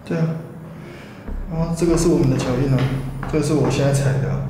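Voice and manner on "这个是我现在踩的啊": ordinary delivery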